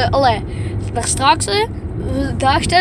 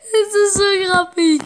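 laughing; 7 semitones higher, 3 dB louder than usual. Real, not acted. Laughing at the spinner.

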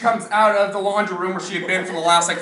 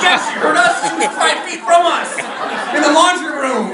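alarmed brother; 6 semitones higher, 6 dB louder than usual. Retold or imitated.

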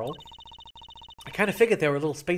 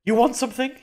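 a husky voice, higher and louder; 9 semitones higher, 9 dB louder than usual. Acted. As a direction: give it a husky edge. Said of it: "Oh, that husky voice".

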